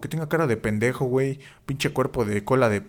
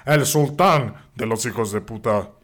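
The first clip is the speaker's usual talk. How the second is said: mock-grand build to the name; put-on announcer voice; 4 dB louder, slower than usual. Host presents co-host as sultan, announcer voice. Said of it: "voy a hacer otra voz".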